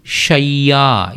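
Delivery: షయ్యా drawn out slowly with doubled య; slow careful pronunciation. Shown as a demonstration. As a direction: say షయ్యా very slowly to show the య ఒత్తు. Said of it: "చూడండి, యాకి యావత్తు". (షయ్యా నెమ్మదిగా య ఒత్తుతో)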